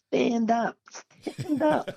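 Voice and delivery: high, small distant voice calling up; a little ant yelling from far below; tiny far-off voice. Retold or imitated. Photographer far below calling up, small and high pitched. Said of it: "this little ant down the bottom say".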